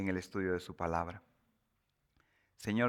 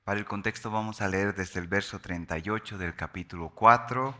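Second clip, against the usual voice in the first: ordinary talk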